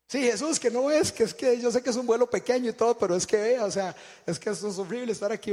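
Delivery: loud, high-pitched frantic whine; panicked pleading. Acted. Scared flyer justifying himself to Jesus, frantic and loud.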